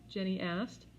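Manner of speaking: usual speaking voice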